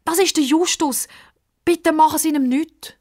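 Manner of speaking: high pleading voice; pleading girl. A narrator's reading. Pippa pleads with old man, high pleading. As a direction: beg him to spare Justus.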